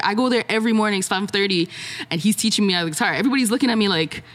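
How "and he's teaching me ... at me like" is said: usual speaking voice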